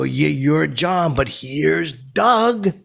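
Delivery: slow, high drawn-out announcer call; announcer intro. Retold or imitated. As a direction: stretch it out like introducing the host. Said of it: "'Cause I go".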